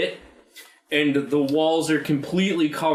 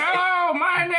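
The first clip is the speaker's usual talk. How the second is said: put-on old man shout. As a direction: bellow a hello as the old boss. Old man boss introduces himself.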